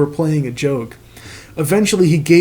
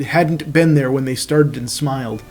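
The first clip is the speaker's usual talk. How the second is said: ordinary delivery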